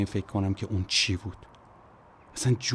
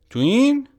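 high, loud mocking repeat of the question; sneering high echo; 10 dB louder, slower than usual. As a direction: throw his words back at him, jeering. Man mocks the question.

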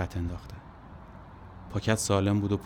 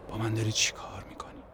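soft whisper to the sky; hushed desperate question; whispered plea; 5 dB quieter than usual. Acted. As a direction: whisper it up at the heavens in despair. Alone in truck, asks the sky.

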